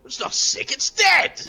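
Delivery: high, loud exclamation; exasperated retort. Acted. Warrior correcting the cafe owner, exasperated. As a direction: blurt out the correction, peaking on the end. Acted retort about dead dragon.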